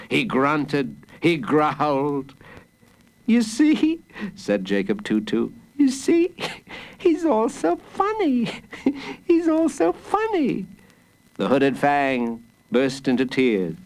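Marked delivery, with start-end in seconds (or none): 3.3-4.0 put-on high-pitched little boy's voice; high, eager child voice; child hero. A narrator's reading. Child hero points out joke. 5.8-10.7 put-on high-pitched little boy's voice; high, eager child voice; child hero. A narrator's reading. Child hero repeats himself, amused.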